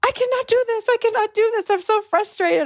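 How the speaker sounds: very high-pitched imitation of frustration; exasperated girl. Girl complaining to neighbor about the snorer, exasperated. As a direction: play a fed-up girl, far up in pitch.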